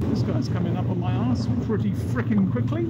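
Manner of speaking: talking inside a motorcycle helmet; muffled helmet mic over engine noise. Real, not acted. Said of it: "in-helmet sound check".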